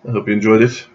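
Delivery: usual speaking voice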